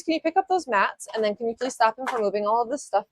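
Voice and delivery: ordinary talk, nothing marked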